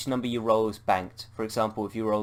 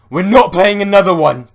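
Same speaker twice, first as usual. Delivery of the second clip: much higher and louder scream; 10 semitones higher, 18 dB louder than usual. Acted. Bob screaming that the game is over. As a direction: scream it, refusing outright. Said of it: "Bob screams".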